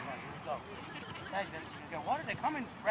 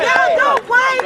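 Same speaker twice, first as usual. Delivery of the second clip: sideline yell; 26 dB louder, much slower than usual. Real, not acted. Coach yelling on the sideline.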